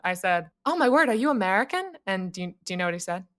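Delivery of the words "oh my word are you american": higher-pitched, friendly surprise; friendly, hopeful. Retold or imitated. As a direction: reenact a delighted question to a fellow countryman. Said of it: "felt so friendly".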